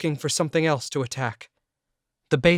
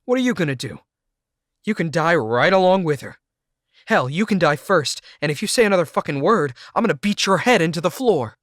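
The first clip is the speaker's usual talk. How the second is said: loud, higher furious threats; threatening shout; 5 semitones higher, 8 dB louder than usual. A narrator's reading. Jake threatening to beat the narrator, shouting louder. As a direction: threaten him, yelling.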